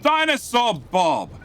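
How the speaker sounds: loud, drawn-out call of a name. Real, not acted. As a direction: hail him across the room. Calling out to a viewer.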